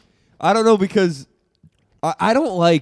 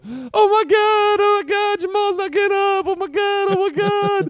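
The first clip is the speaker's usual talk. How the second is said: screaming crying fan. Retold or imitated. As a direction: shriek it over the injured player. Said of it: "one of the football wives going".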